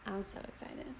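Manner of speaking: barely voiced, hushed excitement; real low murmur, as if secret; very quiet. Real, not acted. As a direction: say it almost in a whisper. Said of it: "Why are you talking so quiet?".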